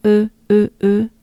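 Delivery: "E" as rounded "euh"; French e sound. Shown as a demonstration. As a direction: push lips forward for French "E". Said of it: "is very French".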